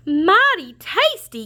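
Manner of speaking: high, slow shocked repeat; horrified echo of the words; alarmed shout. Acted. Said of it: "Cassie shouted".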